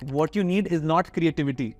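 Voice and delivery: ordinary talk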